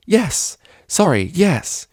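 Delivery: high, flustered outburst; startled yes. A narrator's reading. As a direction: jolt back and blurt it. Startled out of her thoughts.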